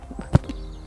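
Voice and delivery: soundless tearful half-laugh; a breath of a laugh through welling emotion; choked laugh. Real, not acted. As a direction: laugh it off as the tears come. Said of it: "I'm getting emotional".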